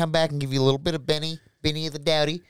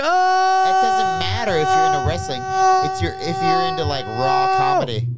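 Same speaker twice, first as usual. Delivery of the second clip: loud insistence; 12 semitones higher, 5 dB louder than usual. Real, not acted. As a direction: drive the point home. Heated defense.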